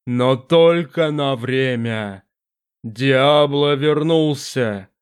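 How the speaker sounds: low, slow, echoing demon voice; a vast presence speaking from the dark; booming demon voice. Acted.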